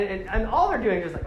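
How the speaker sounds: normal talking voice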